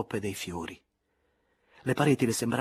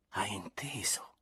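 slow, soft whispered plea; tender whisper; 9 dB quieter, slower than usual. A narrator's reading. Elena repeating to Andrea, tender whisper. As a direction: breathe the question tenderly. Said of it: "ripeté con una voce anche più tenera e più sommessa".

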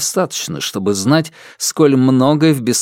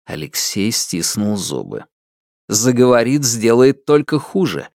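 ordinary delivery

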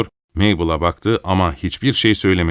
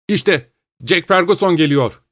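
high-pitched, mildly raised announcement; calling out; 9 semitones higher than usual. A narrator's reading. Malcolm at the window announcing a visitor, mildly calling out. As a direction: call it out without a full shout. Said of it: "diye bağırdı".

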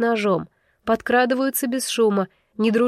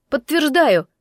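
higher, raised-voice interjection; shout; 5 semitones higher, 4 dB louder, slower than usual. A narrator's reading. Narrator backs the snake from the crowd, shouting. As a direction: call it out in support. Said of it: "крикнула уже я".

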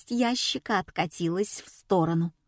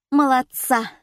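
high, loud praise; approving; 6 semitones higher, 6 dB louder than usual. A narrator's reading. Assistant Elza praising the heroine, approving. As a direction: cheer her on approvingly. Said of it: "одобрила Эльза".

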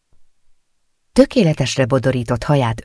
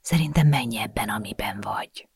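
voiceless whisper, fading out; 7 dB quieter than usual. A narrator's reading. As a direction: whisper it like a conspirator. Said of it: "suttogott most az apja is". (suttogva, összekacsintva)

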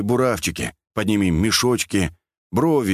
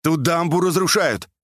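high raised voice; urgent raised-voice call; calling across; 8 semitones higher than usual. A narrator's reading. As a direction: shout the news over a distance. Shouting across the water.

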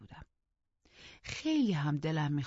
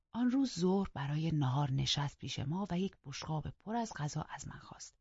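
ordinary delivery, nothing marked

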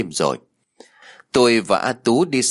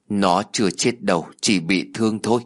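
ordinary delivery, nothing marked

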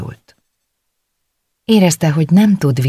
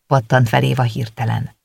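ordinary delivery

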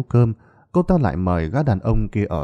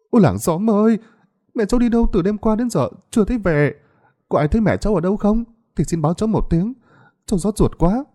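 much higher, loud fake lament; wailing out loud; 12 semitones higher, faster than usual. Scheming Tươi wailing to neighbours, hammed-up fake grief. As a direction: put on a show of grief for the village. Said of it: "bù lu bù loa, gân cổ, hét lên thật lớn".

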